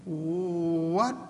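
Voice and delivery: long drawn-out, incredulous question; acted dumbfounded reaction, rising at the end; mock disbelief. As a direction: stretch it out in stunned disbelief. Acted shocked reply to the Lord.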